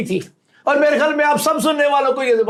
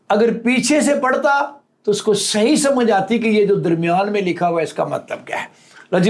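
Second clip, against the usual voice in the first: ordinary delivery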